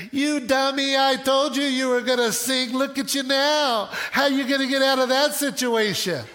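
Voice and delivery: high-pitched taunting I-told-you-so; hammed-up mocking rebuke; mock scolding. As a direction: playfully rub it in. Joke version of Jesus scolding Peter.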